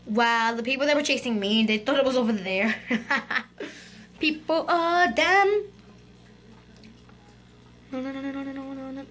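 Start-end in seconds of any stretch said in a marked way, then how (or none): 2.9-5.6 slow, laughing jeer. Real, not acted. Kid mocking the players chasing her, laughing. 7.9-9.1 fading no. Real, not acted. Losing, voice fading out.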